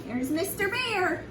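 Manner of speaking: usual speaking voice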